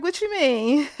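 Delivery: high, loud puzzled question; mock surprised coworker. Coworker taken aback by the rebuke, high puzzled. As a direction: act caught off guard. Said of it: "She was like".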